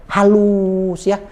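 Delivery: "halus" stretched very slowly; smooth and drawn-out. Shown as a demonstration. Trainer illustrates how Javanese speakers talk, slow and smooth. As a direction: draw "halus" out long and smooth.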